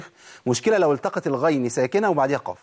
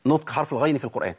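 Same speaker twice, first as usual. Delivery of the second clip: ordinary delivery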